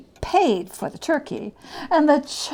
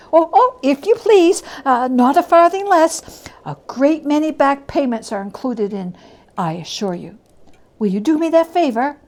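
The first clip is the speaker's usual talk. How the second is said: put-on higher, louder, rushed old voice; eager, pleading Scrooge; 4 semitones higher, 7 dB louder than usual. Scrooge urging the gentleman to accept, eager. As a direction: press the favour on him gladly.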